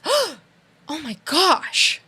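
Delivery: slow, breathless, rising oh my gosh; shocked gasp. Retold or imitated. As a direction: imitate her stunned gasp. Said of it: "she's got real emotion there".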